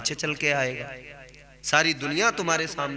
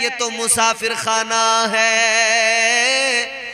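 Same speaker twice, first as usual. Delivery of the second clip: slow, raised sermon delivery; preaching storyteller; 6 semitones higher, 5 dB louder, slower than usual. Acted. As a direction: build the tale like a preacher from the pulpit. Preacher tells story with force.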